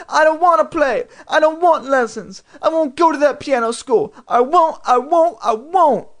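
put-on hysterical child refusing at top pitch; frantic wailing tantrum; screaming. A narrator's reading. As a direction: scream the refusals, high and loud. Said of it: "but I couldn't stop".

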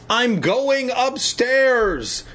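high, drawn-out mock holler; calling out. A narrator's reading. Conan to his parents, calling out slowly and high. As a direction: stretch the words out as a high call. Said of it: "Conan called to his parents".

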